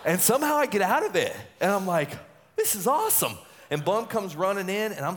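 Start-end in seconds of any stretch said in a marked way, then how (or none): 0.0-3.4 laughing delight. Real, not acted. Gets out of the inning.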